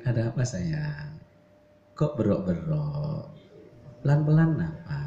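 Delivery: slow, gentle coaxing; soft wife's reply. Acted. Wife soothing the shouting husband, slow and gentle. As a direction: coax him down slowly and softly. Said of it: "itu kamu langsung lembut".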